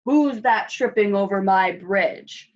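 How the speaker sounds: loud troll challenge; troll's roar. A narrator's reading. Troll challenging a goat on his bridge, loud roar. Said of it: "roared the troll".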